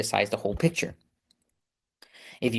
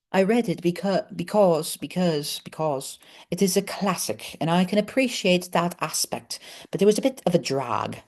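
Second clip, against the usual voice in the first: high, halting put-on English accent; 7 semitones higher than usual. Shown as a demonstration. Vlogger apologising to English people, attempted English accent. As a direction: have a go at an English accent, repeating words to find it. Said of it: "in an English accent".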